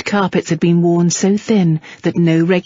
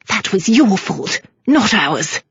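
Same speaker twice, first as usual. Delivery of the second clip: edged with anger; slower than usual. A narrator's reading. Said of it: "her voice edged with anger".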